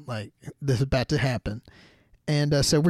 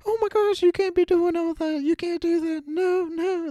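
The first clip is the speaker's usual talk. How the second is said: much higher, shrieking mother's protest; alarmed mom; 3 dB louder than usual. Retold or imitated. Narrator mimicking the mother hearing about skydiving, high alarmed. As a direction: squeal it like a horrified mom.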